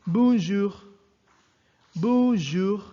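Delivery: "bonjour" pronounced in French, model for the class. Shown as a demonstration. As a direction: say "bonjour" the French way, nasal bon, throat R. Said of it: "So again".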